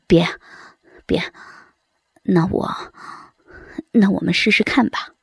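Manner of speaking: low, halting husband's voice; bashful, weak. A narrator's reading. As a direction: give in haltingly, with no confidence. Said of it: "杨伟说起话来扭扭捏捏的".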